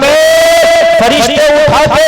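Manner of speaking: loud, driving sermon cadence; full-force preaching. Acted. Preacher urging the gathering to listen, booming. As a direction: preach it hard to the crowd.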